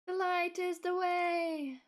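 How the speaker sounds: high, loud and slow oracle voice; grand slow proclamation. Pretend. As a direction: intone it like a divine oracle. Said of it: "repeats line slower, bigger".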